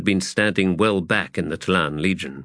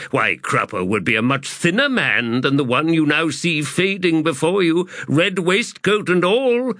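raised, fussy trader voice; boastful old merchant rambling on; high pompous merchant voice; 6 semitones higher than usual. Acted.

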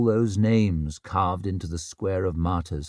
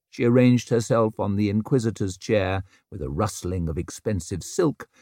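usual speaking voice

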